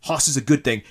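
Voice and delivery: angry. Acted. NPCs Drake and Kumba quarrel.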